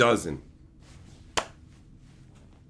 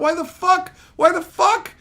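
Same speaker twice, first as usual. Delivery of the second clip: higher, louder disbelieving cry; exasperated yell; 12 semitones higher, 5 dB louder than usual. Real, not acted. Streamer yelling at the pointless shots, exasperated. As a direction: throw your hands up at the screen. Ranting at the film.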